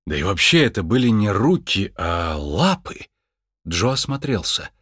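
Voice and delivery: usual speaking voice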